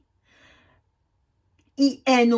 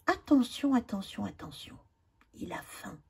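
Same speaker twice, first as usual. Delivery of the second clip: attention said three times, each softer until whispered; fading to a whisper; 9 dB quieter, faster than usual. Shown as a demonstration. Teacher warning the class, fading to a whisper. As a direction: warn gently, trailing off to a whisper.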